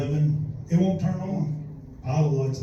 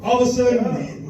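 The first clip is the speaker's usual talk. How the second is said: ordinary delivery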